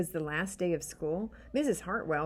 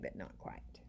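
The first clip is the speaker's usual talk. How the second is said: whispered aside, no voice; 15 dB quieter than usual. Real, not acted. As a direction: breathe it as a secret aside. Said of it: "reader's aside whispered".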